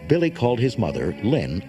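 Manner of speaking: ordinary delivery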